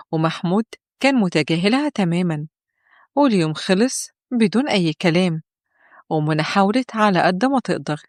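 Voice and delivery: ordinary delivery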